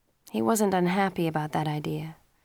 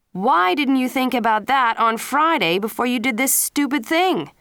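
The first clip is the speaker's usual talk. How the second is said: higher, louder angry scolding; angry father; 6 semitones higher, 6 dB louder than usual. A narrator's reading. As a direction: tear into the son for his stupid stunt. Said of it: "Mister Leary said angrily".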